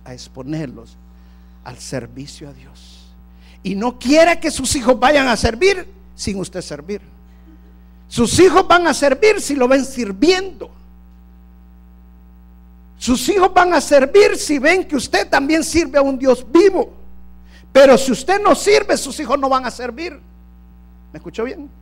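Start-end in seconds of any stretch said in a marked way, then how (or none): 3.6-16.9 loud, high, driving preacher's cry; a pastor thundering at the faithful about football idols; full-force preaching. Acted. 17.7-20.2 a preacher pressing the point home, loud; forceful preached line. Shown as a demonstration. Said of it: "¿Estuvo mal dicho?".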